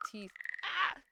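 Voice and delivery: normal talking voice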